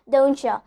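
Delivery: "don't you" becomes doncha; "don't you" with a CH and weak final vowel; linked words. Shown as a demonstration. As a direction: merge "don't you" into don-cha. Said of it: "it's becoming".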